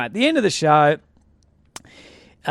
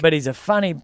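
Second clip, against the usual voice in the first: ordinary talk, nothing marked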